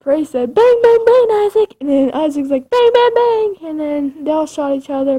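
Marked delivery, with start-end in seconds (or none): 0.5-1.7 high, loud play gunfire shout; shouted bang. Acted. Praise shouting at Isaac while pretending to shoot, loud and high. 2.7-3.6 high, loud play gunfire shout; shouted bang. Acted. Praise shouting at Isaac while pretending to shoot, loud and high.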